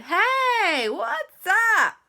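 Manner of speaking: high, loud goofy greeting; funny-mood Andy. Acted. Andy answering the call in a funny mood, high. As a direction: greet like you're in a silly mood. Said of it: "He doesn't normally talk like that".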